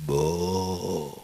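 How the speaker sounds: long drawn-out boo; imitated booming sound. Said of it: "he heard the sound".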